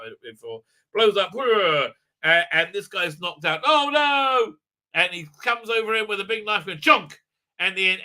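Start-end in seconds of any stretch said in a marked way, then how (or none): 3.7-4.6 high, loud "oh no"; hammed-up cry of alarm; drawn-out fake horror; mock dismay.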